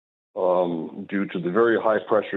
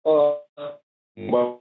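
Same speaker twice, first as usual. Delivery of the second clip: glitchy robotic phone line; choppy, robot-like connection; robotic breakup; 7 semitones higher than usual. Real, not acted. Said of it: "You sound like a robot right now".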